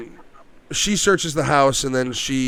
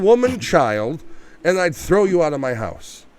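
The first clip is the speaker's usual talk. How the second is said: ordinary delivery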